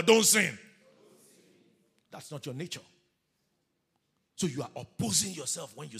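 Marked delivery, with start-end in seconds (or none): 0.0-0.6 loud, high command; shouted exhortation. Real, not acted. Preacher having people tell their neighbor, loud emphatic shout. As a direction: call it out for everyone to repeat. Congregation told to repeat the command.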